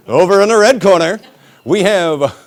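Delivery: loud, high fight-night introduction; mock ring announcer. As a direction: call out the opposing corner like a boxing MC. Mock boxing introduction of governor.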